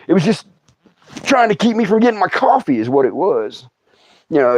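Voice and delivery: ordinary talk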